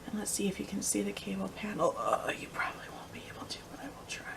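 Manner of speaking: half-whispered aside while fiddling with the camera; very soft, trailing into a whisper; hushed muttering. Real, not acted. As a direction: drop to a soft, breathy murmur to yourself. Muttering while adjusting camera view.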